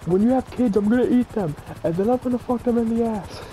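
squeaky high voice; high, silly put-on voice; high-pitched mock voice. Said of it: "he's like".

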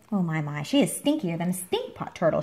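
usual speaking voice

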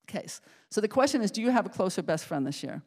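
usual speaking voice

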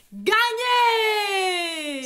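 high, loud drawn-out win; triumphant shout. Real, not acted. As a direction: stretch out the victory word. Claims victory again.

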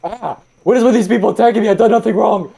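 high, hurried whiny complaint; mock indignant protest. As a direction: protest your innocence, hammed up. Protests being attacked in game.

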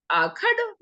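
high, startled "this pit?"; puzzled surprise. Retold or imitated. Boy surprised the master calls the pit a wrestling ring, startled. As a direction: sound baffled at the pit. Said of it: "મેં પૂછ્યું".

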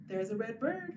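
ordinary talk

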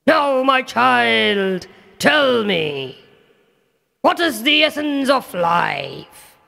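slow, high, coaxing king voice; sly father king. Acted. Demon king coaxes Prahalad, slow sly question. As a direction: lure the child into the answer.

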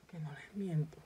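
whispered promise to viewers; whispered aside to the camera. Real, not acted. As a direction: breathe it as a secret.

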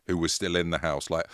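ordinary talk

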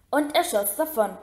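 usual speaking voice

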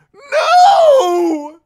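long screaming high wail; desperate shout. A narrator's reading. Prisoner screams at the slammed door, desperate shout. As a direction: scream it out, held long and very loud. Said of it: "he shouted".

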